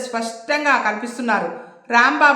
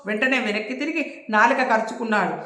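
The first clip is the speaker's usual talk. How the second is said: usual speaking voice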